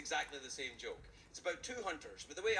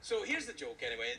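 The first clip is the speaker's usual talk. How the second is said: ordinary talk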